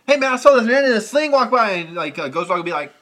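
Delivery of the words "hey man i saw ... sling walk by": high, loud imitated friend; retold eager exclamation; excited French friend. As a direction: call it out brightly, pitched way up. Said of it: "talking French. He's like".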